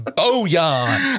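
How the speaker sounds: Southern drawl. Retold or imitated. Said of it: "you just go, you know, really deep into the South".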